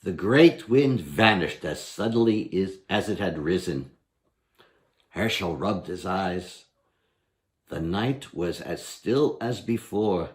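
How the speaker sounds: ordinary delivery, nothing marked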